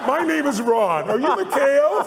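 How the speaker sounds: high, slow, loud friendly reintroduction; Reagan's fresh start. Retold or imitated. Reagan reintroducing himself to Mikhail, hammed-up high friendly voice.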